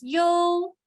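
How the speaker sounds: "yo" held high and level; first tone yo. Shown as a demonstration. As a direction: sing "yo" flat and high. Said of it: "general tones".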